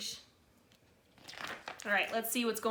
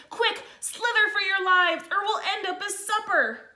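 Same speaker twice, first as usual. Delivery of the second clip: higher, louder hurried alarm; urgent panic; 9 semitones higher, 4 dB louder than usual. Acted. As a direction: urge everyone to flee. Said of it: "cried the snails in panic".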